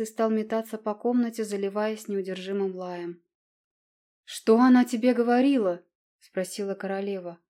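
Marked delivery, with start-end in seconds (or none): 4.2-5.8 sharp, louder question; the queen presses her son, loudly; loud demand. A narrator's reading.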